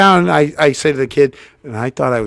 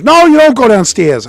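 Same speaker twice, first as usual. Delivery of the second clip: high, loud mock scolding no; loud refusal; 10 semitones higher, 9 dB louder than usual. Brother George forbidding him to go downstairs, loud. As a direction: bark it like a bossy brother.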